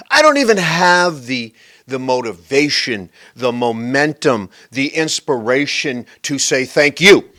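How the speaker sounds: loud, emphatic mock rant; exaggerated loud complaint; mock outburst. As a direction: belt it out like an exasperated confession, landing hard at the end. Mock rant voicing the lazy thanker.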